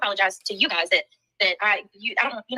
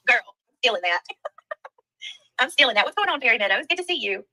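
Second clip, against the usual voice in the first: ordinary talk, nothing marked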